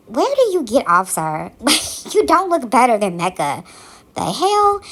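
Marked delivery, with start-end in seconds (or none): 4.1-4.8 disbelief. Real, not acted. Reacting to the show in disbelief.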